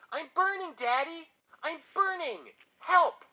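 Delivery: very high, loud fake cries for help; shrieked imitation of a burning boy; mock screaming. A narrator's reading. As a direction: scream like a child trapped in a fire, hammed up. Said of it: "started screaming again, even louder this time".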